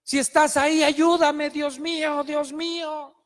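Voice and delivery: a lost climber crying out to God; desperate cry for help. Retold or imitated. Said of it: "empieza a gritar".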